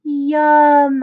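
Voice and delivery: loud drawn-out yum of pleasure; happy yum. A narrator's reading. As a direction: savor the sugar out loud. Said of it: "I said".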